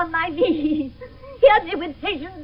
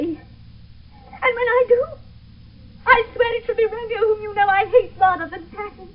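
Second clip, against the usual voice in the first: tearful defiance; 5 semitones higher than usual. Acted. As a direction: refuse through tears. Juliet defies her mother.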